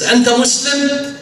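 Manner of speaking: high, slow, stretched-out question; amazed disbelief. Acted. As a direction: gasp it in wonder. Son amazed at the name.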